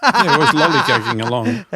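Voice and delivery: laughing through the words; chuckling while speaking. Real, not acted. Laughing at friend's word.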